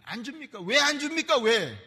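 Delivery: indignant complaint building. Retold or imitated. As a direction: rise into an outraged why. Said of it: "이렇게 하고 있는 거예요".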